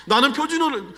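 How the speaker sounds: normal talking voice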